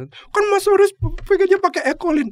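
high, loud, pleading junior. Retold or imitated. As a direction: raise the voice into a tearful junior's plea. Junior voiced. (meniru suara junior yang tinggi dan memelas)

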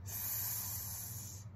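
long, very soft hiss; snake hiss. Acted. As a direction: hiss faintly like a snake in leaves. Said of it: "I hear a hiss".

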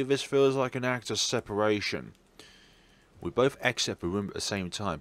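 ordinary delivery

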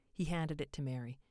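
ordinary delivery, nothing marked

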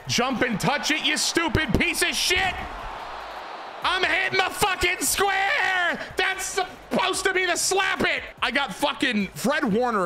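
screaming at the screen, pitch way up and slowing on the last lines; enraged gamer yelling at his players; furious shout. Real, not acted. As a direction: yell at useless receivers. Yelling at his receivers in game.

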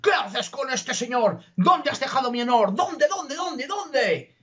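the count demanding where his honour went; outraged count. Acted. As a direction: rant like a wounded nobleman. Outraged count confronts his daughter.

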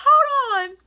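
high, loud alarmed stop; startled hold on. Retold or imitated. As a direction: freeze them in panic. Deans swarm her at school.

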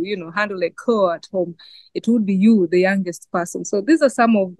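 usual speaking voice

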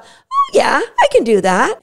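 jumps high on oh yeah, then settles; bright voice of a dog keen to say yes; eager dog yes. Pretend. Dog voiced saying yes happily.

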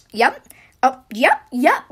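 quick loud yeps, imitating an eager listener; nodding vice principal. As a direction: mimic someone nodding along over and over. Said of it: "nodding her, her head, like, up and down".

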